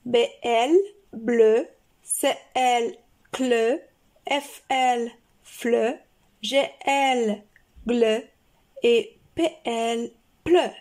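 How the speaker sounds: letters named, then each syllable said crisply; spelled-out syllable demo. Shown as a demonstration. Teacher to children, clearly sounding out letter pairs. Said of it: "nous allons étudier les syllabes complexes".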